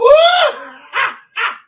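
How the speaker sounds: loud, high burst of laughter. Real, not acted. Speaker laughing at his own squirrel joke, loud laughter. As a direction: crack up out loud.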